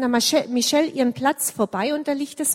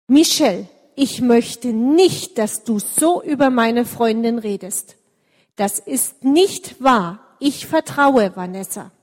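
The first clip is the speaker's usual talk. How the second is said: louder, slow, firm stand for a friend; 6 dB louder than usual. Acted. Claudia stands up to Michelle, loud and firm. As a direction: plant your feet and declare it loudly. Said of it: "stellt sie sich hin und sagt ganz laut".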